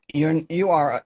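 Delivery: ordinary delivery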